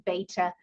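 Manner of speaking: beta said as BAY-tuh; American "beta". Shown as a demonstration. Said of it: "how they say it in the U S".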